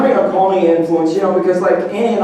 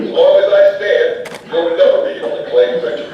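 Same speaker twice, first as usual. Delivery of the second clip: bad foreign voiceover. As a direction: play it like a cheesy dubbed action hero. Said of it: "bad foreign voiceover movie".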